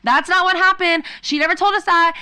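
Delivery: loud high protest; indignant shout. Retold or imitated. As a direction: shout it in disbelief. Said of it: "And she was like".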